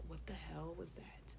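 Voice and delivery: low, slow, whispery aside; hushed disbelief. Retold or imitated. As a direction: mutter it under your breath in dismay. Voices her stunned inner reaction.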